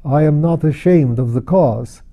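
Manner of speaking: ordinary delivery, nothing marked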